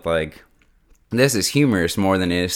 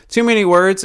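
exasperated quip; 10 semitones higher, 6 dB louder than usual. Real, not acted. Mocking the long premise.

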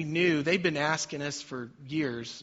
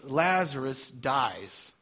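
slow grave statement; 2 semitones lower, much slower than usual. Real, not acted. Sermon climax.

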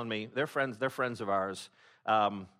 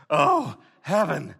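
relieved sigh; 2 semitones higher, 10 dB louder, much slower than usual. Retold or imitated. Relieved sigh at finish line.

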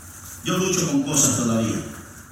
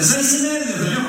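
pitched up, cheeky last offer; higher wheedling haggle; 8 semitones higher than usual. Retold or imitated. Preacher as the officer offering six and a half, cheeky high haggle. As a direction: try one more sly bargain, pitched high.

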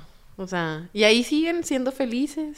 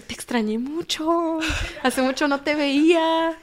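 theatrical fake sweetness; 6 semitones higher, slower than usual. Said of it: "el más actor".

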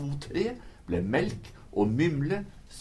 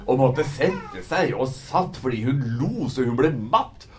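usual speaking voice

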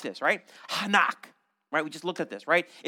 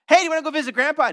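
high, loud, fast, bouncy invitation; cheery parent invite; 9 semitones higher, 6 dB louder, much faster than usual. Acted. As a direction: sell the visit to grandpa eagerly. Parent calling child in imagined scene.

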